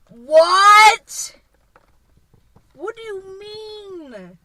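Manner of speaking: high, loud "what" into a drawn-out wail; incredulous outburst. Retold or imitated. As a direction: stretch it out in high-pitched disbelief. Acted stunned reaction to the apology.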